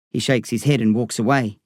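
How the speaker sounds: ordinary delivery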